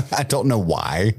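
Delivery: normal talking voice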